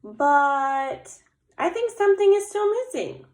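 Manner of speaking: high, sing-song little bear voice; Little Bear pondering. Acted. Little Bear studying her snowman, high pondering voice. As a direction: ponder aloud like a small storybook bear.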